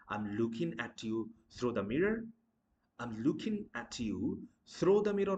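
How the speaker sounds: through said as "throw"; mispronounced through. Shown as a demonstration. Teacher acting the mistake, through sounding like "throw". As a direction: put an "oh" vowel in through. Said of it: "not throw".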